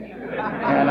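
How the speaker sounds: ordinary delivery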